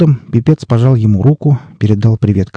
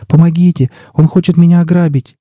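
higher, pleading call for help; a girl begging for rescue, raised; mild pleading cry; 9 semitones higher than usual. A narrator's reading. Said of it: "взмолилась девушка". (мольба о помощи, высоко)

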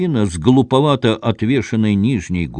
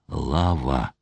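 low, soft, breathed name; breathed in fear; 8 semitones lower, 6 dB quieter than usual. A narrator's reading. Husband breathes his wife's name in fear, soft and low. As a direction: gasp her name out in dread. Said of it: "выдохнул он в страхе".

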